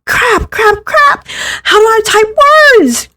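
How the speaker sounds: very high, squeaky flustered cursing; spiralling into full panic; frantic panic. Acted. Can't phrase question, panicking.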